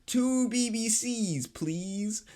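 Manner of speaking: teasing a friend's order, drawn out slowly; slow mock order. Mocking how his friend ordered at the drive-thru, slow and high. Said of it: "but he goes".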